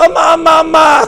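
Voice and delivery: high, shouted pleading cries; cling and cry mom through the scolding; child crying for mom. Retold or imitated. Child clinging to a scolding mother, shouting and crying.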